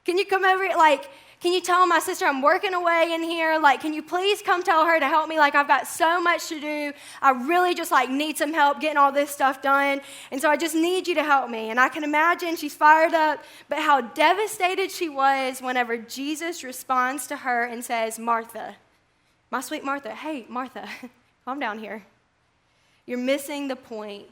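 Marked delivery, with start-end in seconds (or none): 0.1-11.7 tattle to the authority, all worked up; mock-exasperated Martha begging for backup; worked-up pleading. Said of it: "She's probably worked up". 18.2-24.3 soft, tender calming voice; gentle correction. Retold or imitated. Jesus gently answering worked-up Martha.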